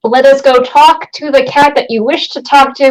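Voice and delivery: ordinary talk, nothing marked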